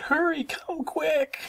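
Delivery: very high, drawn-out plea for help; scared cub calling parents to the rescue; high-pitched frightened cry. A narrator's reading. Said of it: "she cried".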